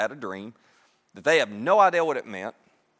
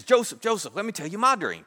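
higher-pitched, eager acted quote; eager excitement; 4 semitones higher than usual. The baker can't wait to tell Joseph his dream, excited. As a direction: call his name with mild excitement. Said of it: "he's all excited".

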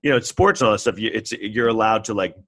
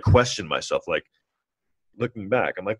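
normal talking voice

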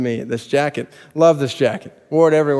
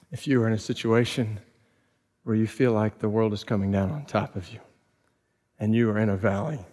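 soft, lower and quieter; tender pastoral appeal; 3 semitones lower, 5 dB quieter than usual. Acted. Preacher to a suffering congregation, soft and tender. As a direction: comfort the hurting congregation gently.

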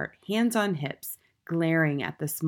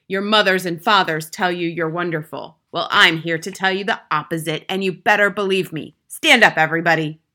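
loud, domineering harangue; forceful contemptuous schoolmistress; tyrannical headmistress, scornful; 9 dB louder than usual. A narrator's reading. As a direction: harangue the class, then order them up.

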